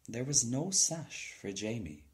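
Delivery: usual speaking voice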